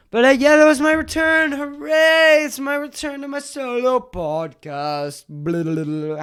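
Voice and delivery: mock celebration. Real, not acted. Host cheering his podcast return to listeners, in a hammed-up high voice. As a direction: announce it like a cheesy game show host.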